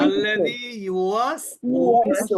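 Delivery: very slow ইউওয়াসউইসু with a rounded, lip-spread উই; teacher models the correct reading for students; correct recitation. Shown as a demonstration. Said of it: "সবাই বলি".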